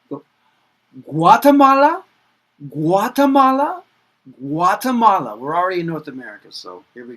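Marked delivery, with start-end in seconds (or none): 1.0-5.4 Guatemala called out high and loud, rising at the end; high, loud model pronunciation. Shown as a demonstration. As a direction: belt Guatemala out for the class. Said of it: "teacher models country names for repetition".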